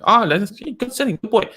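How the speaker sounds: put-on high-pitched praise for a little child; enthusiastic praise. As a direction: praise him like a delighted parent. Models praising a seated child.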